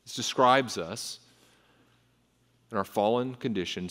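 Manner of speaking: ordinary talk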